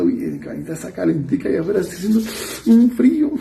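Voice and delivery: voz mimosa que tienta, con subida aguda al final; enemigo tentador, mimoso. Acted. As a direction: tienta con ternura fingida, arrastrando el frío. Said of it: "Y es el enemigo parado ahí a un lado diciendo". (el enemigo seduce a quedarse en la cama, mimoso)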